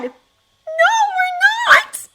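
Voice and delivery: put-on high, loud child's wail; girl wailing. A narrator's reading. Frightened sister wailing back at her brother on the ice. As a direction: wail it like a panicked little girl. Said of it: "she wailed".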